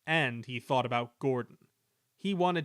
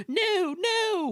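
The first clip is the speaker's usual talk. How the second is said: much higher, louder frightened wail; 6 dB louder than usual. A narrator's reading. Empty trucks cry out to the runaways, high louder wail. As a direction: wail in alarm. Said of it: "wailed the empty trucks".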